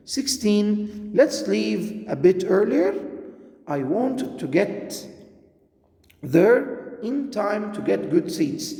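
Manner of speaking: very slow, careful reading of the sentence; slow exercise read. Shown as a demonstration. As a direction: spell it out slowly for the class. Teacher reads exercise sentences.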